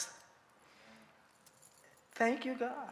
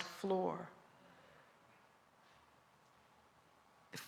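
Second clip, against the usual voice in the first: calm gentle wise voice. Acted. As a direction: savor each image gently. Poetic wisdom litany.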